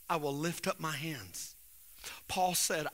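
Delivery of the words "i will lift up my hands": soft quote. Retold or imitated. Repeats quote softly.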